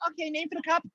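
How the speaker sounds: very high-pitched mock barista. Barista asking customer for a name, high mock voice. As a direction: pipe up as a squeaky counter server. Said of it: "so you use different voices".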